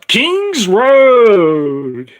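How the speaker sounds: slow, loud, stretched-out title; drawn-out announcement. Real, not acted. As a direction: savor each word grandly.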